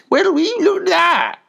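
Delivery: high, loud exclaiming farm woman; farmer's wife. A narrator's reading. Farmer's wife seeing piglet with dog, loud amazement.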